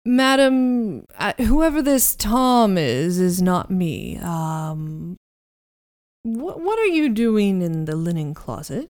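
lightly voiced indignant butler, loud at first; a hint of flustered butler; muffled butler. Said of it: "You hear his muffled voice".

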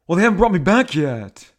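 indignant. Acted. Shatner replies before his space trip, indignant.

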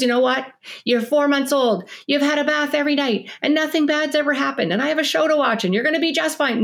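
dismissive parent. Retold or imitated. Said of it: "Nobody goes".

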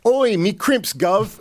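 chirpy fake London accent; playful cod-Cockney "Oi, me crimps, gov"; mock Cockney. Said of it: "I was on the verge of going".

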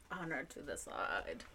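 laughing, hushed echo of the phrase; low-key, tickled aside; amused echo. Real, not acted. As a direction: repeat it softly through a laugh. Amused echo of the odd motto.

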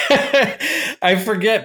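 laughing at himself. Real, not acted. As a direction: giggle through the words. Laughs while answering.

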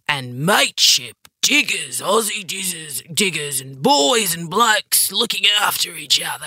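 put-on broad ocker Aussie bloke accent; Australian man voice. Mocking the blokey Australian mateship ideal in an ocker accent. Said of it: "that's my Australian man voice".

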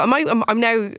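usual speaking voice

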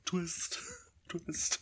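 unvoiced whisper; breathy aside whisper. Joking punchline.

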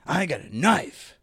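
lightly voiced sketch character; a hint of Colby's menace. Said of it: "this character that Amy Poehler did".

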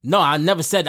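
ordinary delivery

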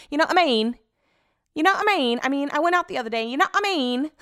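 high, loud, exaggerated English accent; mock English accent. Podcaster mocking the phrase they say most, hammed-up English accent. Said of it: "I do the worst English accent".

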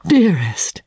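a breathed endearment; whispered with tenderness; tender whisper. Acted. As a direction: say it like a secret. Tender word at parting.

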